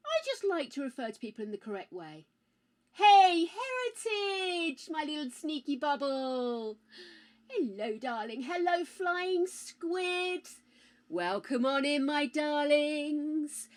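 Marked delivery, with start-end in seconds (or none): 2.9-6.8 slow, stretched-out name; drawn-out greeting. Pretend. Streamer welcomes a viewer with a slow stretched name. 8.4-13.7 slow, stretched-out hello; drawn-out welcome. Pretend. Streamer welcomes a viewer in a slow drawn-out hello.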